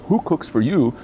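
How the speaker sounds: hooted in the owl's rhythm, "Who cooks for you?" with a rise on "Who"; barred owl call. Retold or imitated. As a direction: hoot it like a barred owl calling out. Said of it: "The barred owl says".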